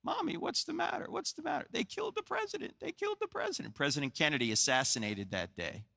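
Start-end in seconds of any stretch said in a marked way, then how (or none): 0.0-1.7 put-on high, soft little-kid voice; small child's voice. Toddler asking his weeping mother what's wrong, high soft child voice. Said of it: "my mother is sitting on an ottoman". 1.7-3.7 very high, soft mock weeping; imitated weeping mother. Mother telling her child of the assassination, high hushed distress. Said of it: "weeping uncontrollably".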